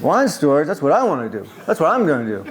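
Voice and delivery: imitating a Greek accent, amused and eager; lightly accented, excited and laughing a little; hint of a Greek accent with a laugh. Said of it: "he had a thick accent".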